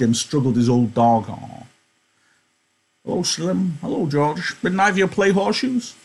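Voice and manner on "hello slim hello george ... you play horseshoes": put-on high, thin old-timer's voice; old Candy voice. Old Candy greeting Slim and George, high-pitched. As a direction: pitch up into a creaky old ranch hand.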